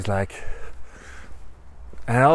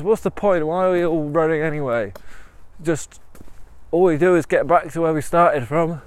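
higher, louder, exasperated protest; exasperated runner; 6 semitones higher, 5 dB louder than usual. Acted. Weary runner protesting to tiger, exasperated. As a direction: protest the pointlessness, voice pushed up and loud.

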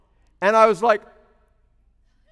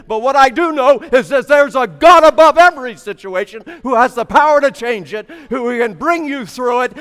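full-force preaching; 3 semitones higher, 7 dB louder than usual. Acted. Preacher exhorting the congregation at full force. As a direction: preach it out to the back row.

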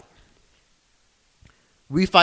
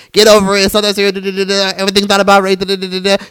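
high, droning mock quote; mocking naysayers; 6 semitones higher, faster than usual. Podcaster imitating people quick to dismiss racism, mocking. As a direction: parrot it high and dismissive, trailing into babble.

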